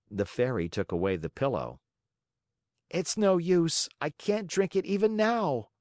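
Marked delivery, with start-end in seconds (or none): 2.9-5.7 put-on whiny child. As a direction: whine it out, dragging. Puppet refusing.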